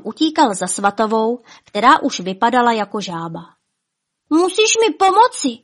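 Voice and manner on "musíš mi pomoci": high, urgent, loud plea; crying out. A narrator's reading. Špuntík begs Svatava for help, crying out. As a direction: cry out for help, pitched way up. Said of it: "vykřikl".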